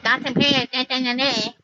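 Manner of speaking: high, nasty-nice taunting chant; put-on mocking voice, trailing into nonsense syllables; mocking singsong imitation. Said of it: "you wanna use it like".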